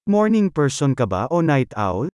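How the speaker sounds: ordinary delivery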